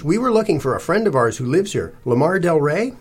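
high, careful, rising tone; polite inquiry. A narrator's reading. Cece asking the stout lady after a friend, polite. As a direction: ask sweetly, lifting the name at the end.

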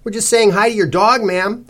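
high, chirpy politeness; brightly. A narrator's reading. Cece to the dog's owner, brightly. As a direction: sing it out cheerily and a bit loud. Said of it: "Cece said brightly".

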